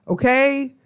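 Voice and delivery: ordinary delivery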